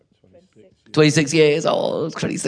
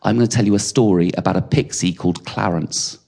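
gentle storytelling dad; 7 semitones lower than usual. Retold or imitated. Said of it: "and I'd say".

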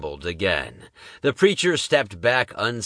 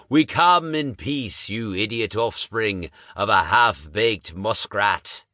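fiery preacher. Acted. Preacher calls the monster to repent, booming. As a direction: preach at the beast like a tent revivalist.

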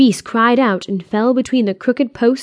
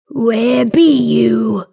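slow, drawn-out troll call; troll calling for the hidden human; annoyed troll; 4 dB louder, much slower than usual. A narrator's reading. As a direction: call out like a sniffing, irritated troll. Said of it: "its throaty voice hollered in annoyance".

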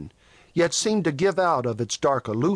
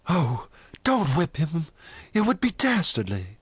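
plaintive, raised-pitch plea; imploring; 4 semitones higher than usual. A narrator's reading. Jane begs Toll not to whip Venters, imploring. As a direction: beg with failing courage. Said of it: "implored Jane".

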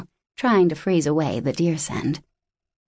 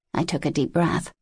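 ordinary delivery

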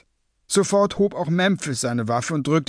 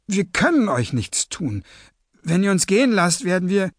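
higher, urgent pleading; frightened young captive; 6 semitones higher than usual. Acted. Captive begs gunmen to let them go, high pleading. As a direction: bargain anxiously in a raised voice.

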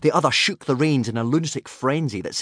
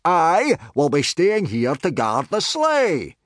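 high, slow yelled announcement; drawn-out teacher's yell; 7 semitones higher, slower than usual. A narrator's reading. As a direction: bellow orders to the class, stretching words. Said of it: "yelled Gobber".

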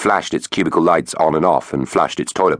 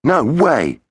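Finn's refusal; 10 semitones higher than usual. Acted. Finn refusing loudly.

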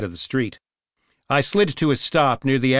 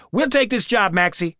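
tough cop woman; 9 semitones higher than usual. A narrator's reading. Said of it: "she said".

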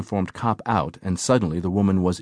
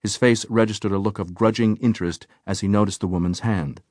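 plain reading voice

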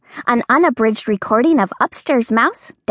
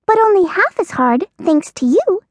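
mouse voice; 9 semitones higher than usual. Acted. Mouse character voice.

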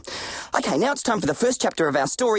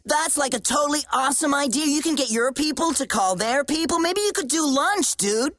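put-on American accent; 6 semitones higher than usual. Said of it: "stop speaking like that?".

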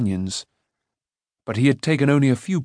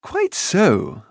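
higher, smiling voice; pleasant; 7 semitones higher than usual. A narrator's reading. Rune answering Taran with a smile. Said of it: "smiling pleasantly".

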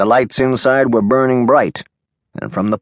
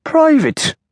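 "Private" jumped up high in a scoffing echo; mocking echo; 4 dB louder than usual. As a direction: toss "Private" back high and loud, mocking it. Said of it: "McGillicuddy repeated".

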